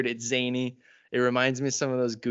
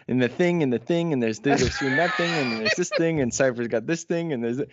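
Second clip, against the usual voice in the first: mock rambling; 2 semitones higher, 4 dB louder than usual. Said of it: "where you go".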